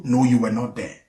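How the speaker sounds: ordinary talk, nothing marked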